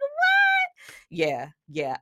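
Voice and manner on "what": a much higher squeaky what; mock stunned squeak; shocked. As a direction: gasp it out in disbelief. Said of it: "I was shocked".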